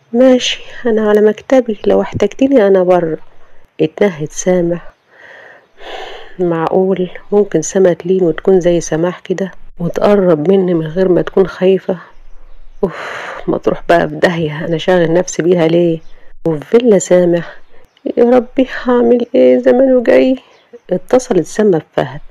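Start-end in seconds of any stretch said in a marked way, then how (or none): 0.1-3.2 higher, lightly voiced young woman; the secretary answering her boss, a higher female voice; Samah's voice. 18.2-20.4 put-on higher, anxious young woman; worried Sama.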